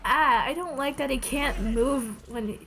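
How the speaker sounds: ordinary talk, nothing marked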